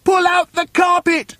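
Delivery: very high, full shout; high-pitched urgent yell; commanding shout. A narrator's reading. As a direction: bellow the order to the whole gang. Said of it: "shouted Mugglewump".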